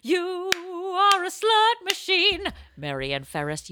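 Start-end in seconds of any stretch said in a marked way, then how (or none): 0.0-2.4 mock exclaim.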